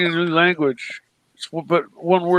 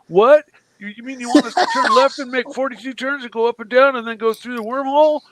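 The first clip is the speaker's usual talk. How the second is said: incredulous retelling; 7 semitones higher, 4 dB louder than usual. Retold or imitated. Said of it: "and they'll be like".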